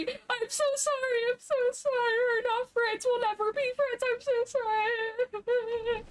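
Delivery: high frantic laugh-cry of sorry; panicked apology. Real, not acted. Creature turns on her.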